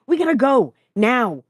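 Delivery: loud, high and urgent; urgent insistence. A narrator's reading. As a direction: push them to move at once. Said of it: "Jason insisted".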